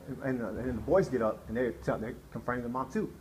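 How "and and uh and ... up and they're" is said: ordinary talk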